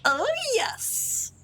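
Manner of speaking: squeaky high imitation of her reply; high mock echo. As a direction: parrot her answer in a tiny high voice. Mimics character's reply.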